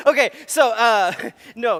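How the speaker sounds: high, loud laughing words. Real, not acted. Pastor laughing at his own Boston joke.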